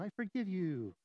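soft, emotionless parody of forgiveness; hammed-up pious calm; mock saintly. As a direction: hush it like an exaggeratedly serene saint. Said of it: "forgiveness meant that I had no emotion".

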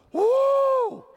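a sudden high, loud whoop. Real, not acted. Preacher whoops to the congregation at the oil, high and loud. Pouring oil on his feet.

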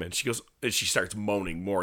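normal talking voice

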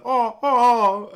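high, loud laughing gasps; hammed-up choking noises; mock choking cries. Man choking on a hot dog, mock gasps.

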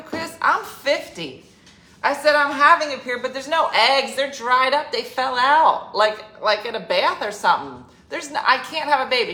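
exasperated insisting. Retold or imitated. Retelling insistent reply to Chris.

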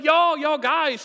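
high, loud, slow calling out over a storm; acted Jesus calling out to his friends; excited call. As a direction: shout across the waves to the boat. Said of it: "Jesus is like".

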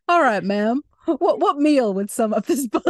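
host questions in a joking sheriff voice. Pretend. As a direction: play a folksy sheriff for laughs. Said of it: "Why am I still doing this voice?".

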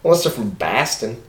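bad put-on Boston accent. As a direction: lay on a clumsy Boston accent. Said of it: "No, that was terrible".

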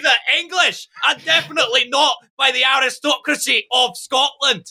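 high, strained Scottish nationalist rant; put-on Scottish accent. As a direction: declaim grievances in a hammed-up Scottish accent. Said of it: "to do that accent".

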